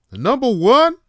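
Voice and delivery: high, loud shout-out; excited shout. Real, not acted. Vlogger shouting out to moms, high and loud. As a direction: belt it out like a hype cheer.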